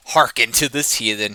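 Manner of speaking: loud, high mocking bellow. Rogue mocking the barbarian before the tavern crowd, bellowing. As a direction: roar it out to the whole room. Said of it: "he bellowed".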